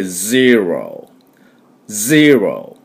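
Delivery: ゼロ stretched very slowly as English zero. Shown as a demonstration. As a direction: draw out ゼロ with z and relaxed r. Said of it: "こういう風に発音します".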